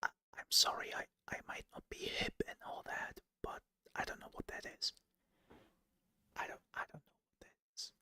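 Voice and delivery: fast, hushed whisper; whispered confession. Real, not acted. Streamer admitting ignorance of slang to chat, whispered confession. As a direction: whisper an apology, hurried and very soft.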